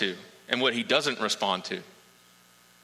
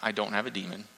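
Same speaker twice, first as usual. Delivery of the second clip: softer and lower, unbothered and calm; calm denial; 6 semitones lower, 6 dB quieter, faster than usual. Acted. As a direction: answer quietly, not provoked at all. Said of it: "Jesus just kind of standing there going like".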